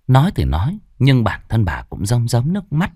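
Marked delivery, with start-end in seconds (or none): none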